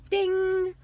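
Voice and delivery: sudden high, loud ding; idea ding. Pretend. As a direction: ring it out like an idea clicking. Light bulb moment, sound effect.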